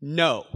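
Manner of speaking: a loud, final no; emphatic no. Shown as a demonstration. Preacher showing Jesus' answer, emphatic. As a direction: plant the word no like a wall. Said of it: "he answers with this emphatic".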